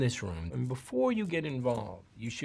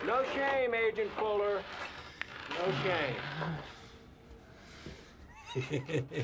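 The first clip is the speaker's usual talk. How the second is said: mocking singsong; 8 semitones higher, 4 dB quieter than usual. Taunting agent in singsong.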